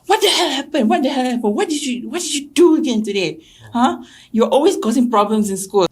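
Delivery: high, loud mock scolding yell; dad screaming at his kid; retold screaming parent. Parent yelling at child, mock shriek. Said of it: "screaming at me".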